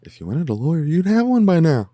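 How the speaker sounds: playful ADR of the lawyer line; mock dubbing. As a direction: overdub it in a silly high voice. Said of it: "I'm gonna do the A D R".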